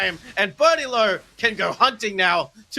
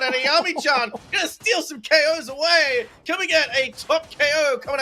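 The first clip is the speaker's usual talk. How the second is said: excited; 8 semitones higher than usual. Real, not acted. Caster hyping a steal.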